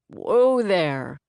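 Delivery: a lightly deepened man's voice; deep voice. A narrator's reading. Stranger blocking the running boy, lightly deep and calm. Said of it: "came a deep voice".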